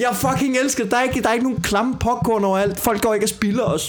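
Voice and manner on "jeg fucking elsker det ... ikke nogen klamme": excited exclamation. Real, not acted. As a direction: pitch jumps way up with delight. Enthusing about the cinema.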